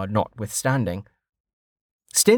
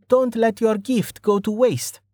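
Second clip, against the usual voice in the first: remembered voice, pitched high; Amelia; 11 semitones higher than usual. A narrator's reading. Woman's remembered advice to rider, high voice. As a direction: say it high, as an echoing reminder. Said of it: "Amelia's words popped".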